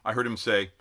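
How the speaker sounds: ordinary delivery, nothing marked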